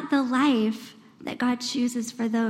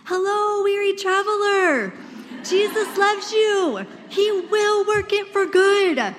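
higher, slow, sugary-sweet greeting; higher, slow, mock-cheerful; mock Happy Land cheer; 6 semitones higher, slower than usual. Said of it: "wanna yell at them from Happy Land".

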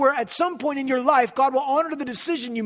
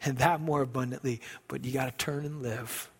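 gentle, hushed scripture quote; quoting Jesus softly; 11 semitones lower, 9 dB quieter than usual. Acted. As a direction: speak the words of Jesus as a hushed promise. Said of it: "Jesus said".

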